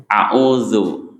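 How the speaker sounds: a'udzu with the tongue forward and bitten slightly; correct a'udzu. Shown as a demonstration. Teacher modelling the right sound for students, careful demo. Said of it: "Kedepankan dan sedikit agak digigit".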